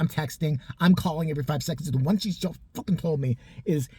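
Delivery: usual speaking voice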